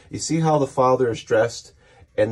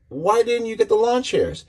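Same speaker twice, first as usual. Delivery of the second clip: mock complaint pitched way up; retold nagging complaint; 10 semitones higher than usual. Husband questions wife about lawn chairs, retold nagging. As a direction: imitate a spouse whining the question up high.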